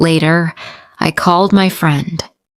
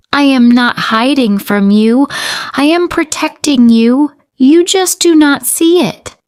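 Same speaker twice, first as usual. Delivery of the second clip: rising insistence; 7 semitones higher than usual. Acted. Mother insists to her daughter she is protecting her, rising. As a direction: build up, pleading to be understood.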